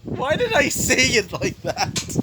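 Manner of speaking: high, slow, cracking up; laughing disbelief. Real, not acted. Storyteller questioning own silly voice, high laughing. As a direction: squeal it through real laughter.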